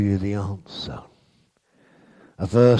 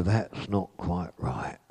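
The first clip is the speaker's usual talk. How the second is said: gentle spirit whisper; 3 semitones lower, 7 dB quieter, slower than usual. Retold or imitated. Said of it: "the Holy Spirit just whispers".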